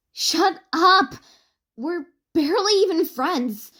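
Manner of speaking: high, loud protest; flustered shout. A narrator's reading. An smacking her teasing friends, flustered shout. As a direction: yell it, embarrassed.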